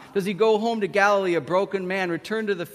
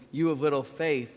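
ordinary delivery

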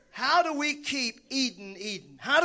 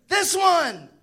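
louder, higher, thrilled; excited exclaim; 7 semitones higher, 7 dB louder than usual. Pretend. Man exclaiming as he first sees woman. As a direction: burst out at the sight of her. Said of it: "the man exclaimed".

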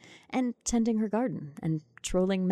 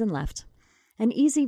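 plain reading voice

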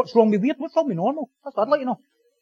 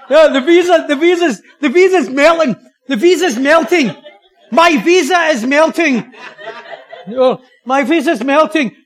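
high, loud, drawn-out cries; hammed-up miracle announcement, stretched out; mock jubilant proclamation; 4 semitones higher, 7 dB louder than usual. Congregant claiming the visa is melting, mock ecstatic.

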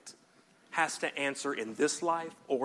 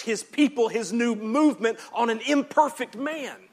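raised pitch, loud emphatic sermon; impassioned preaching; 6 semitones higher, 7 dB louder than usual. Acted. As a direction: press the point with mounting fervour. Preaches at full force.

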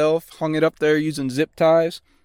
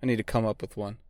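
normal talking voice

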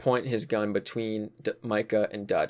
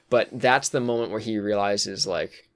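usual speaking voice

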